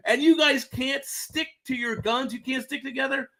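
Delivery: high, slow, exasperated tirade; mock outrage at the squabbling churches; indignant scolding. Indignant convert scolds quarreling Christians.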